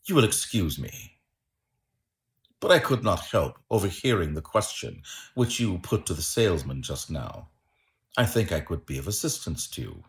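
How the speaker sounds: low, soft, composed gentleman; smooth, quiet politeness. A narrator's reading. Holmes offering assistance to the startled man, quietly polite. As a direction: offer help discreetly, voice kept down.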